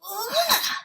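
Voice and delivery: loud, high ugh of disgust; disgusted sputter. Acted. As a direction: spit out a loud high yuck. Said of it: "she sputtered".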